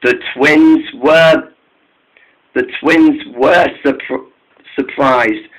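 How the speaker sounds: ordinary delivery, nothing marked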